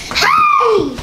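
high, loud shout; a sudden high yell; shouted hey. Real, not acted. Boy interrupting his brother's video, shouted hey. Child calls out during video.

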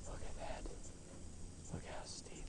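breathy hushed whisper. Real, not acted. Skier whispering to the camera about the slope, hushed. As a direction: barely breathe the words out in awe. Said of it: "Why am I whispering?".

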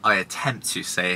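ordinary talk

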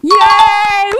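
loud happy cheer. Real, not acted. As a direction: shout for joy. Cheering a right answer.

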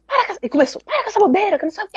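mock scolding husband dismissing her decision; retold scolding. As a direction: imitate a husband snapping at her, pitched high. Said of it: "Brigou, brigou, brigou".

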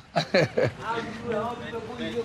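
high bursting laugh; big laugh. Real, not acted. Joking about being fun.

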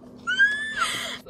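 shrieky, very high laugh; helpless high laugh; high-pitched laughing squeal. Real, not acted. Reacting to embarrassing runners' story.

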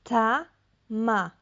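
"Ta, ma" said as two separate syllables with a gap; split into syllables. Shown as a demonstration. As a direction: sound it out slowly for a child. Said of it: "Posłuchaj". (dzielenie na sylaby)

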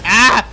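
short, high, loud yell; startled yelp. Real, not acted. Gamer attacked in the police station, startled yelp. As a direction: yelp as if suddenly hit.

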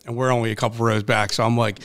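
normal talking voice

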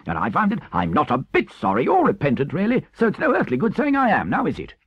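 swooping high, effusive voice; excitable, conceited Toad; unrepentant, impatient Toad. Acted. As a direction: gush mock praise and wriggle out of it. Toad explains away his repentance.

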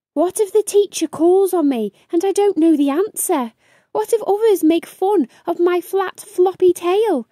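high, fast, worried rush of questions; anxious little beaver. Acted. Brave fears classmates will mock him, high fast fretting. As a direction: worries tumbling out quickly. Brave frets about first day.

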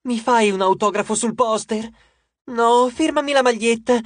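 high, excited fangirl pleading; squealing rivalry for an autograph; clamouring fans. A narrator's reading.

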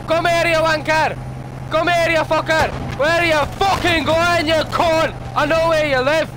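high furious shouting; angry yelling. Real, not acted. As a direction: scream abuse at the enemy. Raging at enemies in game.

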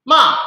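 short, loud MA falling from high; fourth tone. Shown as a demonstration. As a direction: drop MA sharply from a high start. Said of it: "Oke, terakhir".

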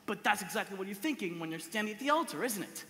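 ordinary talk, nothing marked

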